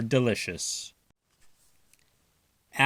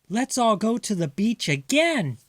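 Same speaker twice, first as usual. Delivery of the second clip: Mother; 10 semitones higher than usual. Acted. Mother voiced high.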